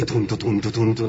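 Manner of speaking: low thumping beat sung by mouth; imitated music. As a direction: pulse out a bass "tum, tu". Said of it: "la música acá".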